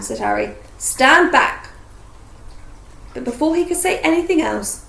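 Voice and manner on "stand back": loud, higher order; commanding. A narrator's reading. Harry about to blast the door, loud command. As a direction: order them out of the way.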